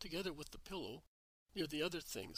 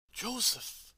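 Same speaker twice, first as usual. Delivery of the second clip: high, loud cry of a name; sudden loud call to her husband; shout; 8 semitones higher, 7 dB louder than usual. Acted. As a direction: cry out his name in alarm. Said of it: "shouts Mary".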